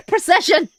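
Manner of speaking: laughing out the word after fumbling it; high laughing triumph. Real, not acted.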